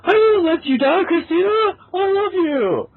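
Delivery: excited shout. Acted. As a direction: shout it out as he runs off on his quest. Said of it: "He shouted to her".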